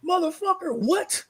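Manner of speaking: high, incredulous outburst; indignant disbelief. Real, not acted. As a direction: pitch it way up in disbelief. Outraged at being called old.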